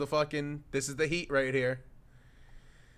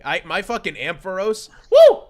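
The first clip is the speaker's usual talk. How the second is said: excited cheer; 6 semitones higher, 15 dB louder than usual. Real, not acted. Cheering for favorite Pokemon.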